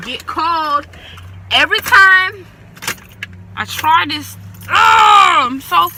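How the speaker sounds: fed-up, rising exasperation; frustrated outburst. Real, not acted. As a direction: burst out in real frustration. Frustrated at car not starting.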